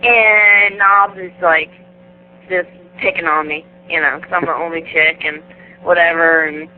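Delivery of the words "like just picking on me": usual speaking voice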